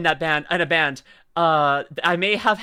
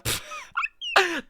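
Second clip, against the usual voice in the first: high-pitched burst of laughter; 5 dB quieter than usual. Real, not acted. Streamer amused by her own take, high laugh.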